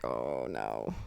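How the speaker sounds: very low, hushed husband imitation; mock dread. Husband seeing wife's Boston side emerge, mock dread. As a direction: drop it deep and soft, as if bracing for trouble.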